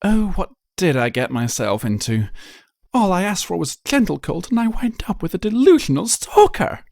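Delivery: high, dramatic lament of a fussy unicorn; theatrical exasperated sigh of a fashionista; dismayed Rarity. Acted. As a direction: wring your hooves over it.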